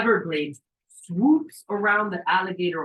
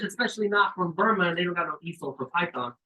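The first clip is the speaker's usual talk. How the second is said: normal talking voice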